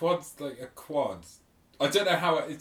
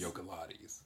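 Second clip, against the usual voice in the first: whispered; 3 semitones higher, 14 dB quieter than usual. Shown as a demonstration. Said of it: "It is a fun word to say".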